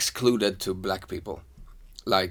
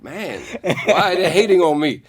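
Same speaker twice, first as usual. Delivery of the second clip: higher and louder, laughing through a mock complaint; bewildered laughing quote; 7 semitones higher, 4 dB louder than usual. Traveler mocking his own confusion at street taunts, laughing and higher. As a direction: play it baffled, with a laugh breaking in. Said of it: "and I'm like".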